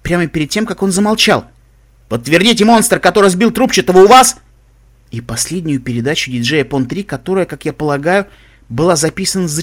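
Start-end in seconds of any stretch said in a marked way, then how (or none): 2.1-4.4 building to a loud, high question; rising panic. Acted. Communications officer calls over the channel, increasingly panicked. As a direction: let the panic climb to the end. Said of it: "растущую панику офицера связи".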